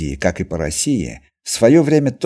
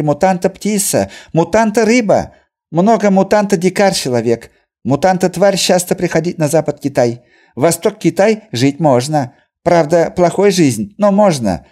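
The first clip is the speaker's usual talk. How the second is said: Chinese merchant voice; 8 semitones higher, 4 dB louder than usual. A narrator's reading. Merchant's grim account.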